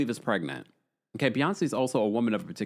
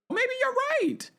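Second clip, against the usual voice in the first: very high, squeaky mock concession; teasing sky-high singsong; playful falsetto. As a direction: flip way up into falsetto. Playful concession to a viewer's claim.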